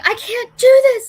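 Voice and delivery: high, loud panicked cry. Retold or imitated. At the shark tank she panics to her friends, high and loud. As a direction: blurt it out in sheer panic. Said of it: "and so I'm like".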